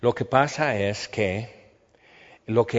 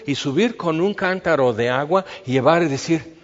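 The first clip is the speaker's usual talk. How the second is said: excited storytelling; 4 semitones higher, 4 dB louder than usual. Acted. Excited retelling of the raid.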